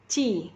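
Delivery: "ci" like a soft c before i, no puff; unaspirated J sound. Shown as a demonstration. Teacher showing how Mandarin J is read, unaspirated. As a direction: keep the air back on "ci". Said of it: "kayak huruf C ngomongnya".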